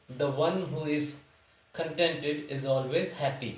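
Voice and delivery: slow, word-by-word dictation of the sentence; slow example reading. Shown as a demonstration. Teacher reads the example sentence slowly to students. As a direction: read it out slowly for the class to follow. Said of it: "reads example sentence slowly".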